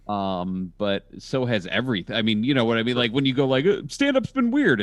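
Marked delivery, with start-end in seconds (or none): 3.7-4.8 whiny mock complaint. Mimicking people complaining.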